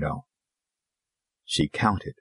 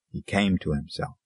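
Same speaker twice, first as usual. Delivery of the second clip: usual speaking voice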